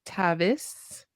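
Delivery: echoing syllable by syllable; staccato. Shown as a demonstration. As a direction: repeat each syllable after a pause. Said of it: "repeat after you syllable by syllable".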